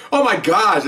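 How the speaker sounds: high, loud mock dismay; shocked exclamation. Supervisor hearing of the baton accident, appalled. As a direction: act out an appalled boss.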